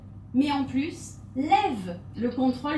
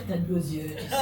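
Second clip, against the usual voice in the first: deeper voice; 6 semitones lower, faster than usual. Shown as a demonstration. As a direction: lower seductive t'as de beaux yeux with a laugh. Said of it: "voix encore plus grave". (voix très grave et charmeuse)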